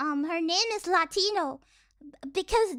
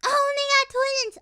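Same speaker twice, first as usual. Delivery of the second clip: high, loud burst of recognition; excited exclamation; 8 semitones higher, 8 dB louder than usual. Real, not acted. Streamer spotting an anime they watched, excited. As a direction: blurt it out with real excitement. Delighted at a title coming up.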